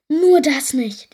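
loud, slow cry of dismay; horrified. A narrator's reading. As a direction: plead in loud alarm. Pirates horrified she might stay. (laut und gedehnt entsetzt)

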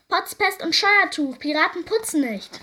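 loud, high outburst of protest; indignant pirates. A narrator's reading. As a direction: refuse loudly, as outraged pirates. (empört polternde Piraten)

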